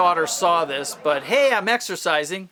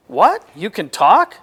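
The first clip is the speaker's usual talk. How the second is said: surprised; 8 dB louder, slower than usual. Acted. Shocked the dog talks.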